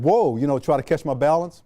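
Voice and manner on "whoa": startled shout. Retold or imitated. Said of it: "I said".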